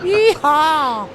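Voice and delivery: loud, high cowboy whoop; yeehaw shout. Real, not acted. Excited booth call-out.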